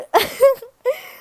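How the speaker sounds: high giggle after the hug; giggle, a little embarrassed. Real, not acted. Laughs after second audio hug.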